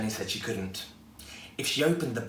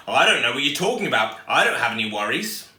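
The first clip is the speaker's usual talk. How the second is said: loud, fast brush-off from a busy big brother; impatient sibling voice, loud and quick; dismissive brother; 8 dB louder than usual. Acted. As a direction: fire it back without looking up from the game.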